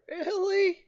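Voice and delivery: very high, drawn-out whine; high plaintive protest; exasperated disbelief. Real, not acted. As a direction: squeak it out in disbelief. Game crashed, disbelief.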